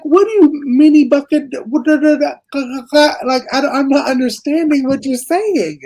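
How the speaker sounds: high-pitched, bewildered babbling; mock-panicked confusion at an incomprehensible accent; baffled gibberish. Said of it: "then I thought it was a different, like, different, like, Spanish".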